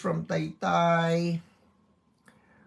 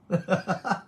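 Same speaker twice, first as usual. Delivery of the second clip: short, high-pitched laugh; 5 semitones higher than usual. Real, not acted. Host amused after remarking on Makati place names, laughing. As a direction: break into a brief laugh.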